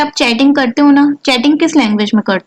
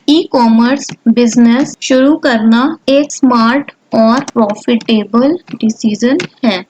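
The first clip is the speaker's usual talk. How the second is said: slow, word by word dictation; slow typing-along dictation; slower than usual. Acted. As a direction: speak each word slowly as you type it.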